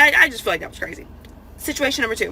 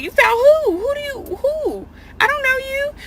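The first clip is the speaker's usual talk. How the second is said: much higher, squeaky, baffled "who?"; incredulous squeal. Retold or imitated. Woman to a stranger who tracked her into the store, high incredulous squeal. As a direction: shriek your disbelief at the stranger.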